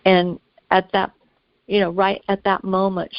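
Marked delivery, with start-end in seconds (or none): none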